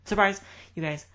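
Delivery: high, loud, mock-surprised reveal; sarcastic surprise. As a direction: gespielt überrascht, spottend.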